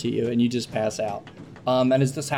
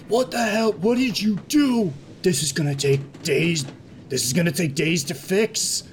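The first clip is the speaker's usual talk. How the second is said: annoyed dockmaster; 4 semitones higher than usual. Acted. Kothak scolding Zira over the wrecked room, mild anger. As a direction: scold the mess like a fed-up foreman. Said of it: "you hear Kothak going".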